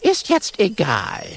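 high, curt lioness voice; a third lioness, dismissive. Acted. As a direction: brush it off. Lioness Gisela.

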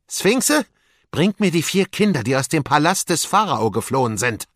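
high, loud summoning call; commanding vizier. Acted. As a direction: summon the creatures and send them hunting. Wesir befiehlt den Sphinxen. (herrisch rufender Wesir)

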